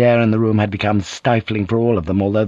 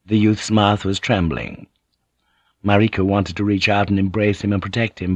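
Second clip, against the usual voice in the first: plain reading voice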